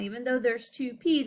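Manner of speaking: ordinary delivery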